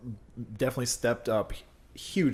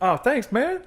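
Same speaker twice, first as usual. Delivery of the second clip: pitched-up flattered thanks; surprised thanks; 12 semitones higher, 4 dB louder, slower than usual. Retold or imitated. Thanking a stranger at the bill counter, pitched up. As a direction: sound caught off guard but pleased. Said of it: "And I was like".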